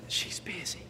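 unvoiced little-boy whisper into the phone. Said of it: "answers the phone with a whisper".

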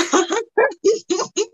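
high, bouncing cartoon chuckle; long high-pitched mock laugh; Goofy. Shown as a demonstration. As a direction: imitate a silly cartoon guffaw. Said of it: "I always impersonated Goofy's laugh".

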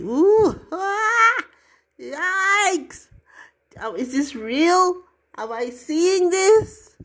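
high, loud startled cries of disbelief; mock astonishment. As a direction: gasp like you've seen a giant elephant. Imagining seeing giant elephant.

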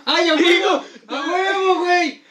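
high, laughing victory whoop; frat bro cheer. Real, not acted. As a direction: cheer like you just won. Cheering a lucky draw.

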